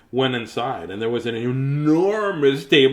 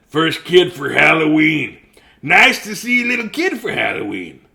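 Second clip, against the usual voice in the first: a hint of a hearty big man; lightly voiced jolly man; 4 semitones higher, 6 dB louder than usual. Big man greets trick-or-treater.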